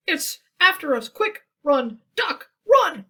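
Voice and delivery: very loud, high, frantic shout; panicked cry. Acted. Goose crying out to Duck to run from the monster. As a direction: scream it as you flee. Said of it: "cried Goose".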